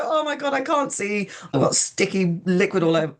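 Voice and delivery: high-pitched, hammed-up flustered complaint; mock panic. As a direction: act out a comic freak-out. Acting out panic she avoided.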